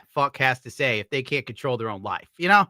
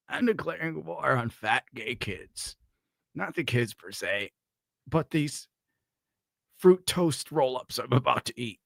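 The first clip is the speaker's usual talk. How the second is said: quoting the clip at home; 5 dB quieter than usual. Retold or imitated. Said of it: "I've been walking around my house".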